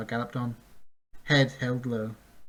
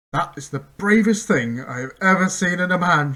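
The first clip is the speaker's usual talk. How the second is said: loud, higher call; 6 semitones higher, 10 dB louder than usual. A narrator's reading. Chester praising Bink, a loud called voice. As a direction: call out in admiration. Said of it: "Chester called".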